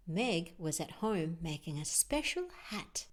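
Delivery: ordinary delivery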